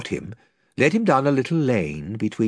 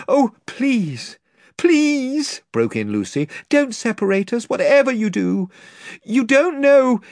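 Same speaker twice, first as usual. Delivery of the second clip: much higher, desperate begging; pleading girl; 12 semitones higher, 4 dB louder than usual. Acted. Lucy begging the lord not to separate them, desperate. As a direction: beg them not to part you.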